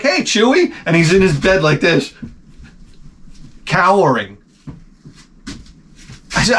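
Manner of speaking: high, sing-song voice to a pet, light and mocking; high voice for the dog. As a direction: coo at the scared dog like a baby. Retelling talking to his dog.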